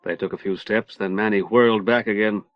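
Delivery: ordinary delivery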